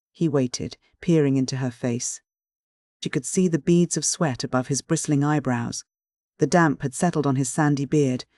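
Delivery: ordinary delivery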